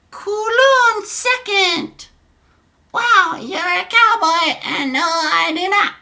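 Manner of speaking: very high, slow put-on girl voice; exaggerated falsetto, drawn out; girl's chat voice. Streamer voicing Sarah's reply to Donnie, high slow falsetto. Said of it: "forgive my voices".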